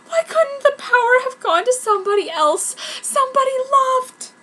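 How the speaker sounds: high, tearful plea. A narrator's reading. Heroine lamenting her unwanted power, tearful. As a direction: let the tears break through. Heroine crying about being hated.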